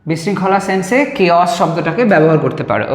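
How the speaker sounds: ordinary delivery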